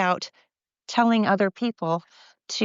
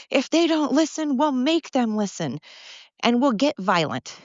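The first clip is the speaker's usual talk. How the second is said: higher, mocking chant of protesters; 5 semitones higher than usual. Vlogger mocking protesters' threats, sneering mimicry. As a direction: parrot their threat back with scorn.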